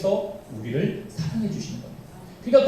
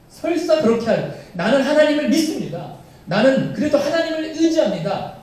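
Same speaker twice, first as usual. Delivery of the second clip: higher, louder declaration of faith; fervent vow; 7 semitones higher, 4 dB louder than usual. Acted. Daniel refusing the king, higher fervent vow. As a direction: proclaim you will not bow. Preacher voices Daniel's confession.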